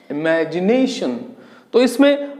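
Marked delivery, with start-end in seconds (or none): 0.1-1.2 imagination slowly, leaning on MA and NA; stressed syllables drawn out. Shown as a demonstration. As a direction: stretch the second and fourth syllables of imagination. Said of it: "इन पे जोर ज्यादा आता है". (Imagination में 'मै' और 'ने' पर ज़ोर, धीरे-धीरे)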